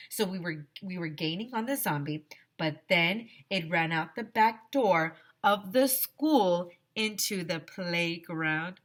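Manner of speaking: ordinary delivery, nothing marked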